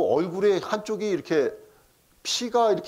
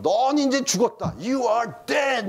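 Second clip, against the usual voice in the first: high, gleeful taunting yell, half laughing; gleeful shout; 7 semitones higher, much slower than usual. Retold or imitated. Son yelling at the bully down the block, gleeful taunting shout. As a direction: crow it out like a kid whose dad is coming. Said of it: "소리를 지르면서".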